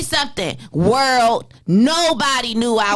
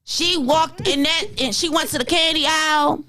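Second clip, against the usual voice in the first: normal talking voice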